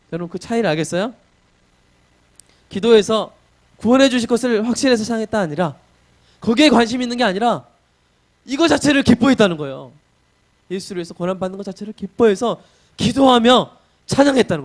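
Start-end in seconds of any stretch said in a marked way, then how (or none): 2.7-14.6 higher and louder, driving the point; full-force preaching. Acted. Preacher telling the congregation of Paul's joy, fiery preaching. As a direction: preach it with fire.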